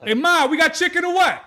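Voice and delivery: hammed-up Italian-American son calling out; Italian-American accent. Man joking about being Italian, calling his ma in an Italian-American accent.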